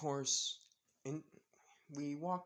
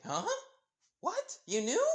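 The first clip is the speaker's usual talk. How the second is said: shocked disbelief; 7 dB louder than usual. Acted. Jesse realizing Izuku knew.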